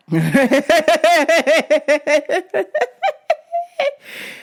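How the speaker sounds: high, rapid cackling laugh; real laughter. Real, not acted. Teller breaking up at his Tommy answer, real laughter. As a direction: crack up at your own joke. Cracks up at own bit.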